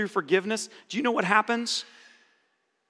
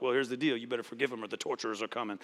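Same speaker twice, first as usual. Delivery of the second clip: low, soft, quick self-parody; deadpan uncle; 9 semitones lower, 9 dB quieter, faster than usual. Retold or imitated. Preacher parodies his own blunt advice to nephew, deadpan. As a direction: toss off the warning dryly, dropping away. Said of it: "his uncle's like".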